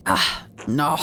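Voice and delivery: low, lightly piratical reply; pirate voice. Acted. Monk answers about reading Italian, low pirate voice. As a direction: drop the pitch into a hint of pirate. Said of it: "let's give him a pirate t-, voice".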